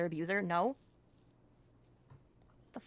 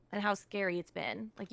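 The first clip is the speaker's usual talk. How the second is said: normal talking voice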